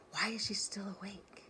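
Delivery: breathy unvoiced whisper, hushed. Girl whispering in the hallway about the guest. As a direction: murmur it so nobody in the house hears.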